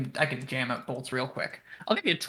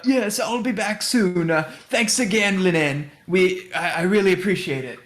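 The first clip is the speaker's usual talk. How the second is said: raised, unhurried character voice; slightly high, drawn-out thanks in character; Sildar; 4 semitones higher, 3 dB louder than usual. Acted. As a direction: play a grateful customer calling back on the way out. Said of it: "that's not his voice".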